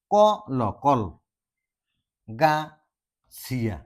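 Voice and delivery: ক ল blended to কল, then গা, then চিয়া, with long gaps; broken into syllables. Shown as a demonstration. Teacher helping with a hard word, syllable by syllable. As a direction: sound out each piece of কল, গা, চিয়া separately. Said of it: "উচ্চাৰণ কৰোঁতে problem হয়".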